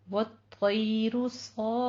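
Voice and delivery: वो तयरु सॉफ़ात recited slowly, heavy त; slow recitation demo. Shown as a demonstration. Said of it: "यहाँ पे हमस करेंगे".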